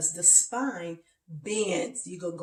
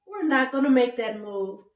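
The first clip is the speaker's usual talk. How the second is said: slightly raised, louder refusal, lightly acted; protesting body's voice; 4 semitones higher, 5 dB louder than usual. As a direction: lightly voice a body saying no. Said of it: "My body was talking to me".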